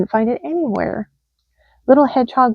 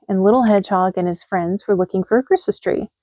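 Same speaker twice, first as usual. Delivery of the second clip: usual speaking voice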